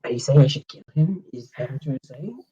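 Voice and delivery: hushed, fading question; soft conspiratorial undertone, dying away; whispered aside. Acted. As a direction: lean in and ask it under your breath. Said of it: "whisper to her".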